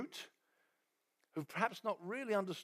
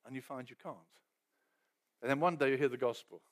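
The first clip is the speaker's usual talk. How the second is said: ordinary delivery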